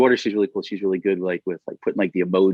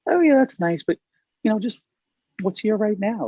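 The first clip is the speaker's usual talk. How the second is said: bright gentle redirect; 12 semitones higher than usual. Retold or imitated. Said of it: "I go".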